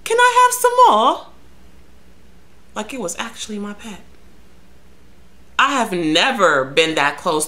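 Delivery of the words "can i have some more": tiny high-pitched begging rodent voice; pleading rat. Acted. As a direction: squeak it out loudly, like a cheeky rat begging. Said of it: "as if to say".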